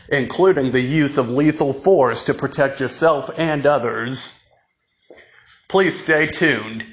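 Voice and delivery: ordinary talk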